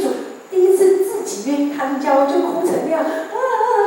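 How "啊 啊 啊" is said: mock wailing sobs, high; a hammed-up retold wail; imitating loud sobbing. Retelling her crying in church, mock high wailing sobs. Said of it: "哭得好大声".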